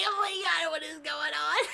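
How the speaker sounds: high, strained retold exclamation; alarmed outcry. Narrator reacts as Gary confronts the lady, alarmed.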